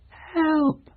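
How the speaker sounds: desperate thin cry; weak cry. Acted. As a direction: call again from far off. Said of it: "a weak cry".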